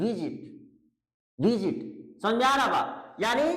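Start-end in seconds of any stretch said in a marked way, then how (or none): 0.0-1.8 visit as VI-zit, weight on the first syllable; lower-pitched visit, ending light; unstressed last syllable. Shown as a demonstration. Teacher answers how to pronounce it, visit stressed at the start. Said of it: "इसके last syllable पे जोर नहीं पड़ता है".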